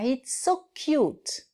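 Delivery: ordinary talk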